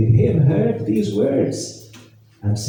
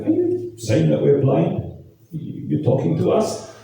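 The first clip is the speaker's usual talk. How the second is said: higher-pitched, incredulous questioning; mock disbelief; 6 semitones higher than usual. Pharisees challenging Jesus over being called blind, mock disbelief. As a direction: act out affronted Pharisees questioning him. Pharisees acted out, taken aback.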